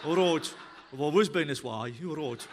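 low attempt at a Birmingham accent; a lightly done Birmingham voice. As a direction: try a Brummie accent and laugh through it. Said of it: "how do you do a Birmingham accent".

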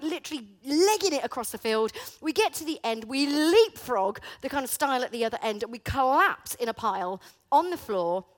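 usual speaking voice

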